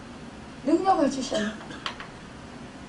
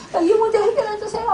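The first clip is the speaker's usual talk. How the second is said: high, louder, fast plea with a laugh breaking into tears; crying plea; 7 semitones higher, 3 dB louder, faster than usual. Retold or imitated. Pastor retelling her own desperate prayer, crying half-laughing plea. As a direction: beg God through half-laughing sobs. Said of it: "울면서 기도를 해요".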